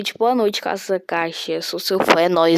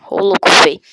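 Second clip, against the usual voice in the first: loud exclaim; 9 dB louder, much faster than usual. Real, not acted. Reacting to chat.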